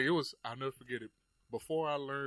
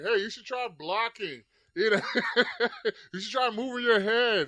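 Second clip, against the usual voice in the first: higher, slow and laughing heckle; mocking sideline heckle; 9 semitones higher, 8 dB louder than usual. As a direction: tease the fighter from the sideline. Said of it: "people on the sideline are talking shit".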